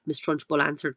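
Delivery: ordinary delivery, nothing marked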